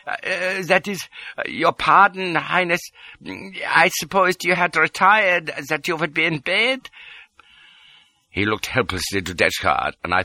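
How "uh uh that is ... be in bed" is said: high, hesitant little doctor; stammering. A narrator's reading. Anxious doctor begs Highness's pardon, stammering. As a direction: hesitate and falter, pitched high. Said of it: "he stammered".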